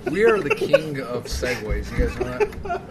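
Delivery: high, slow laughing boast. Real, not acted. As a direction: squeak it out laughing. Joke on segues, laughing.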